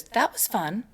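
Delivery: soft, serene little voice; calm child. Retold or imitated. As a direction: say it softly, utterly unbothered. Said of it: "just as calm as can be, she goes".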